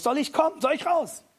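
höher und lauter, drängend fragend. Acted. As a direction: fragen wie einer, der sofort los will. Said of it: "Er fragt Jesus". (Petrus fragt Jesus eifrig aus dem Boot)

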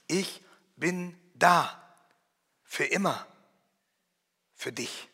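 slow, softening to a hush; tender promise. Acted. Voicing God's promise to the listener, slow and tender. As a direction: let each phrase sink in gently.

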